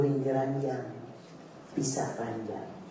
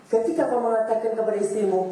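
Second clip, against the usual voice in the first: ordinary talk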